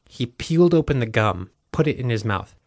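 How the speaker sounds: usual speaking voice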